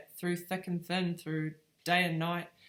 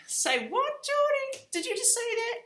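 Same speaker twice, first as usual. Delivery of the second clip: very high, squeaky mock disbelief; astonished. Mum reacting to her son saying mummy, high-pitched astonishment. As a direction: shoot way up in pitch, stunned.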